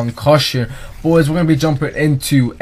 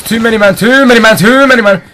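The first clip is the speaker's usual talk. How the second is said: panicking gamer; 7 semitones higher, 7 dB louder, faster than usual. Real, not acted. Swarmed by enemies.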